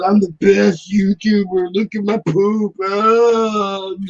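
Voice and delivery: hammed-up influencer showing off; mock boastful YouTuber.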